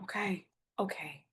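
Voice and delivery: soft whispered okay; very soft, whispered surrender; whispered give-in. Acted. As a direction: back down quietly. Wife backs down softly.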